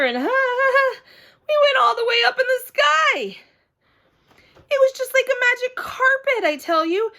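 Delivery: thrilled. Acted. Girl on her first flight, awestruck and laughing. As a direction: gush with wonder at the sky.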